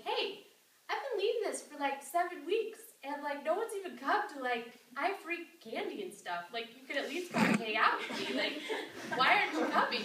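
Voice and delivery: hammed-up plea to the room; playful call-out. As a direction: scold her sisters with a grin. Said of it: "gets up and she's like".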